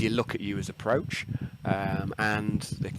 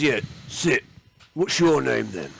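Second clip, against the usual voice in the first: orc boss voice; 4 semitones higher, 5 dB louder than usual. Acted. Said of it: "he says".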